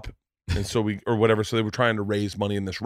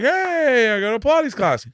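hammed-up crowd cheering his win; mock cheer; 13 semitones higher than usual. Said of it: "And everyone's like".

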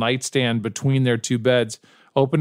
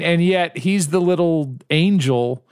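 normal talking voice

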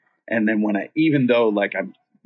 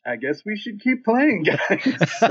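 laughing sheepishly; 11 semitones higher, slower than usual. Retold or imitated. Retells own sheepish line, laughing.

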